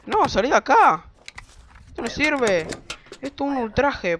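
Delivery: offended yelling; angry outburst. Acted. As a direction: play the outraged reporter. Voices angry reporter in game.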